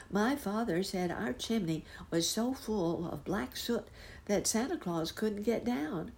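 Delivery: put-on a boy explaining why Santa won't come; glum little boy.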